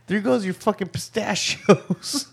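chuckling as the line comes out; laughing through words. Real, not acted. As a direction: laugh it out while telling. Laughing while telling story.

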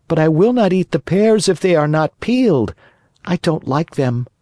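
put-on high-pitched, stubborn child puppet; fussy puppet boy. Pinocchio refuses unpeeled pears, high stubborn puppet voice.

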